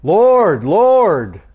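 loud high cry, each word swooping; frantic, emphatic. Acted. The cast away pleading on judgment day, frantic and emphatic. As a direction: cry it out twice, desperately insistent. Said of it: "this is kind of a frantic, emphatic".

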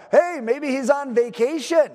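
high, taunting call; jeering, sing-out mockery; mocking holler. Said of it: "He's mocking him".

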